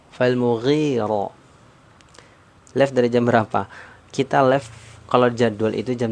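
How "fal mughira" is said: slow chanted Quran recitation; recited verse. Shown as a demonstration. Teacher chanting the verse slowly for students. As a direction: draw each sound out for the class. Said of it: "models recitation of verse".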